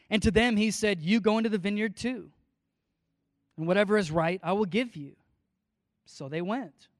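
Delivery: measured, public Bible reading; steady preacher's reading voice; scripture reading. Acted. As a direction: read the passage to the congregation. Reads the parable aloud.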